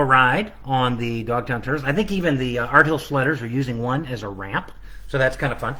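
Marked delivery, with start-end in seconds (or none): none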